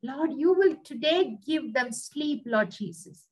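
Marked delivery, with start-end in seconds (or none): none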